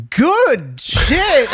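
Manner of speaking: high approving good shit; imitating the neighbor. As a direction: sell it like an impressed old guy. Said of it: "he looks at me and goes".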